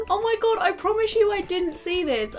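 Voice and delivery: ordinary talk, nothing marked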